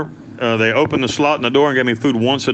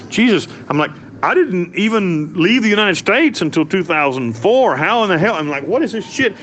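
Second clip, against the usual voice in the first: higher, incredulous outburst; outraged disbelief; 6 semitones higher than usual. Retold or imitated. Prisoner to the police over fake charges, higher, outraged disbelief. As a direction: protest like it's absurd.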